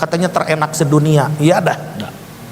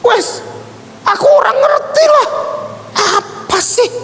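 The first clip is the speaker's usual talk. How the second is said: exasperated retort. Retold or imitated. Preacher replaying his retort to the Javanese pastor, exasperated. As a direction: cut him off, high-pitched and irritated. Retold impatient retort to divine message.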